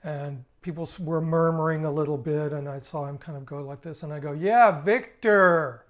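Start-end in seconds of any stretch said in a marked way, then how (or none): 4.3-5.8 loud call of a name. Retold or imitated. Calling again to a man who ignores him, loud. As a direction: shout it across the room.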